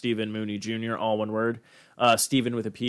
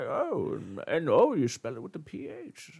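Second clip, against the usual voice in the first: put-on old man; 8 semitones higher than usual. Imitating the old clerk on spelling Stephen, old-timer voice. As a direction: play a doddering old-timer. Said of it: "he was like".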